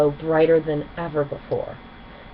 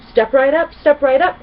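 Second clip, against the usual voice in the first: high, loud carnival barker cry; barker's shout; 8 semitones higher, 9 dB louder than usual. A narrator's reading. Gilda calling crystal ponies to the show, shouting high. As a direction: hawk tickets to the whole crowd. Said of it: "Gilda shouted".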